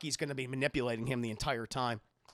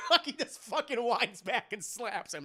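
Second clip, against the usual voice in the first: usual speaking voice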